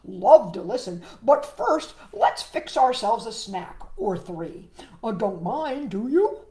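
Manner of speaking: a frog inviting himself in; eager frog voice. Acted. Froggy voice continues.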